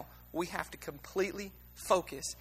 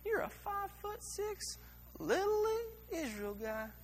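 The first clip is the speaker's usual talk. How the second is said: lion voice; 7 semitones higher, 8 dB quieter than usual. Retold or imitated. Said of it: "He's like".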